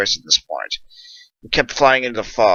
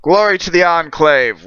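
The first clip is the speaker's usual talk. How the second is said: unison salute reply; 8 semitones higher, 5 dB louder than usual. Acted. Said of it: "we said in unison".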